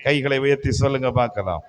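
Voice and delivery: normal talking voice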